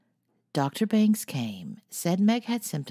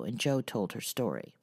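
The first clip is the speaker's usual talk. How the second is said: ordinary delivery, nothing marked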